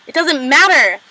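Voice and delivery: mock outburst, pushed up high; retold exasperation. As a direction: imitate someone fed up mid-argument. Acted quote in retold quarrel.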